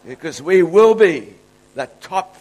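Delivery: building preacher's proclamation, slowing at the end; preaching. Real, not acted. Preacher promising the congregation victory, slow and emphatic at the end. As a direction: drive the promise home.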